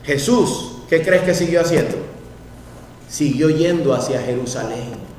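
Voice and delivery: ordinary talk, nothing marked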